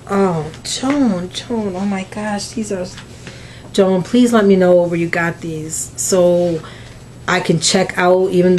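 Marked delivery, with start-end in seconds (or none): 0.1-2.9 hushed awe. Real, not acted. Overwhelmed by the stickers.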